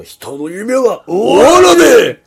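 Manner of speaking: slow, loud, impassioned declaration; dramatic anime shout. Acted. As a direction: belt it like an anime hero. Quoting One Piece shout.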